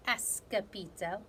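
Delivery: ordinary delivery, nothing marked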